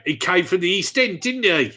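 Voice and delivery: loud, high, hammed-up East End voice; exaggerated East End shout; East End accent. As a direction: belt it out in mock East End. Hams up Alan Sugar's East End roots.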